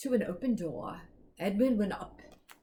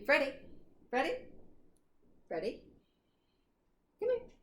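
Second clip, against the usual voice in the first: high sing-song call to a pet; calling the dog; 9 semitones higher, faster than usual. Real, not acted. Reader calling Freddie to say goodnight. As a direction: coax the dog over sweetly.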